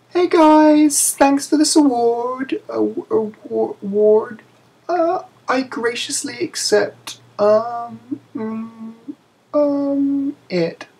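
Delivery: a timid little voice that trips on "award"; squeaky nervous voice. Acted. Stephanie's hesitant acceptance speech.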